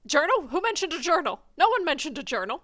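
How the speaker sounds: fast, loud, overplayed innocence; flustered denial. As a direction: blurt it out, a bit too loud and quick.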